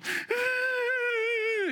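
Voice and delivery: put-on high baby wail; imitated baby cry. Preacher imitates his baby daughter crying in the house, high wail. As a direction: cry out like an infant. Y escucho.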